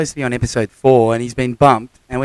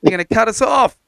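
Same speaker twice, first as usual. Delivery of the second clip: shouting at the batters; 7 semitones higher, 6 dB louder, slower than usual. Retold or imitated. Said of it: "I'm like".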